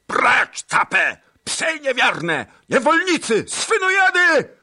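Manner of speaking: very high, loud raging yell; furious roar. A narrator's reading. Tuchaj Bej driving off the Cossacks, furious roar. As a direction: tear into them like a tiger. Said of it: "ryknął".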